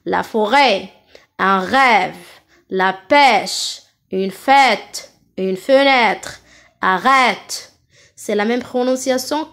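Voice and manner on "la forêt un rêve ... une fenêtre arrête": long open è in "forêt", "rêve", "pêche". Shown as a demonstration. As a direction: open wide on the ê of "fête" and "Arrête". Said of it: "avec accent circonflexe maintenant". (ê ouvert et appuyé, comme dans « rêve »)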